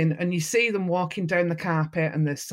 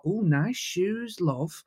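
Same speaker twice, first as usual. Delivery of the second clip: Mancunian compliment; much slower than usual. Retold or imitated. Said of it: "I stand there and go".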